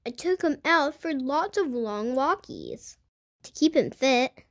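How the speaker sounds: usual speaking voice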